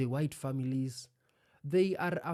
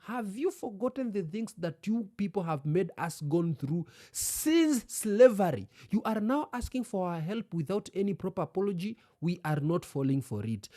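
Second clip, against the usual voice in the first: raised, indignant retort; 5 semitones higher, 4 dB louder than usual. Retold or imitated. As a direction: demand it back in a hint of anger.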